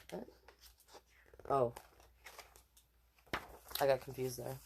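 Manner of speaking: plain reading voice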